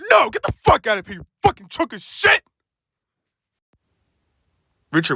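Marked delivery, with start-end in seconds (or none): 0.1-2.4 angry retort. Acted. Angry refusal.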